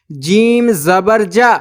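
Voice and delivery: जीम ज़बर जा spelled slowly, landing on a long open जा; slow spelling drill. Shown as a demonstration. Teacher spelling for students, slow drill ending on जा. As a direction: chant it out like a reading drill. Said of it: "हम इस पर लगाते हैं ज़बर".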